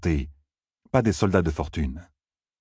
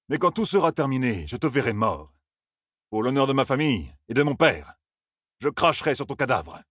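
vengeful officer; 6 semitones higher than usual. Acted. Bornald vows revenge.

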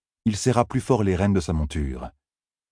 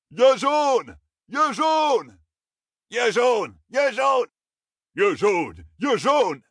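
high, drawn-out acclaiming cries; crowd cheering; much slower than usual. A narrator's reading. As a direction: chant it like soldiers hailing their hero. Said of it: "crièrent certains défenseurs".

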